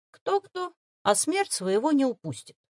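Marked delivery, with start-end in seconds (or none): none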